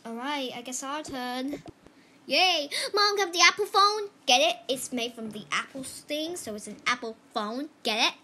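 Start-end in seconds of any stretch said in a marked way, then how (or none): 2.2-4.5 loud high excited. Pretend. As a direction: shout it proudly. Excited joke.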